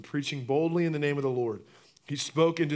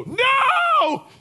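shrieked very high and loud; mock shriek of disgust; screaming no; 12 dB louder than usual. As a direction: scream like you saw a cockroach. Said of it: "and just go".

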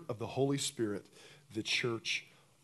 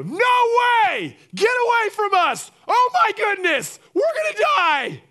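shrill terrified screaming, much higher and louder; mock panic yell, high and loud; panicked shout; 12 dB louder than usual. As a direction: shriek like they're about to die. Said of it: "And they go".

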